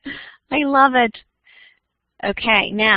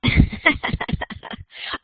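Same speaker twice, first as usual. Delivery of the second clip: voiceless breathy chuckle; amused run of little chuckles; 3 dB quieter than usual. Real, not acted. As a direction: laugh quietly on the breath. Amused reaction before calling Thomas.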